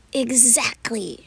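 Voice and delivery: slow, drawn-out gloating; triumphant. Acted. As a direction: savor the win over the child. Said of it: "she says, triumphant".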